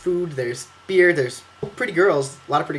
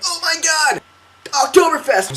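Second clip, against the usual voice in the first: much higher, thrilled cry; mock excited squeal; 12 semitones higher, slower than usual. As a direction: gush like a kid hearing great news. Excited about going to Oktoberfest.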